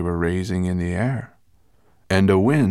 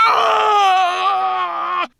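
long high howl, full volume; drawn-out monster scream; howl of pain; 8 dB louder than usual. A narrator's reading. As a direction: howl out loud in agony.

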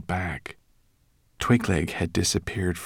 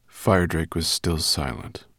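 usual speaking voice

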